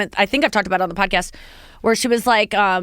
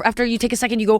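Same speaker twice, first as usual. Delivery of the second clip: normal talking voice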